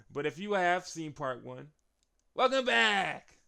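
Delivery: loud, stretched-out welcome back; hyped welcome; excited welcome. Real, not acted. Excited welcome to returning viewers.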